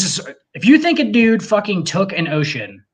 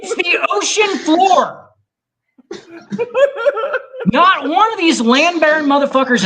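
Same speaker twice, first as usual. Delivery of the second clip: excited rant; 10 semitones higher than usual. Real, not acted. Ranting to friends about parting the sea, excited and high. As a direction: build to a high-pitched rant.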